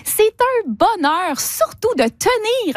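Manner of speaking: high, drawn-out mock rapture; slow, overdone delight, tongue firmly in cheek; ironic gushing. Real, not acted. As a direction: swoop up high and stretch out the bliss. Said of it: "Vous êtes ironique".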